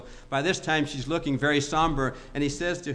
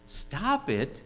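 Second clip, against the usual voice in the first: shocked question; 3 semitones higher than usual. Acted. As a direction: repeat it back in disbelief. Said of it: "And she's shocked".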